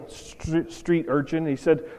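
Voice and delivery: normal talking voice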